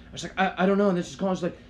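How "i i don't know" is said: high flustered blurt of not knowing; flustered yelp. Retold or imitated. To the girl asking directions, a flustered high blurt. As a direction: caught off guard, yelp it out. Panicked reply to elevator girl.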